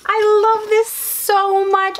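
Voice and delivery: can't contain her love for it; gushing delight. Real, not acted. Gushing over purse.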